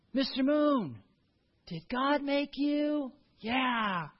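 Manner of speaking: put-on high, slow, wondering child-directed voice; childlike wonder. Grandpa asking the moon for his grandson, high and slow. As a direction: ask the moon like a curious toddler.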